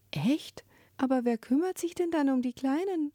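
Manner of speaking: put-on high, shocked child's question; alarmed. A narrator's reading. Scene: Ruru frightened for the abandoned young, alarmed. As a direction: gasp it out as a startled little girl. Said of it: "Sie klang erschrocken".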